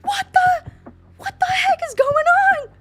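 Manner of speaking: high, loud, laughing amazement; shocked disbelief. Retold or imitated. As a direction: yelp in delighted shock. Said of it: "I was like".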